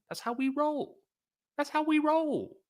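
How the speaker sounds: very high, squeaky mock boast; playful falsetto. Streamer playfully boasting to viewers, squeaky falsetto. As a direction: repeat the catchphrase way up high.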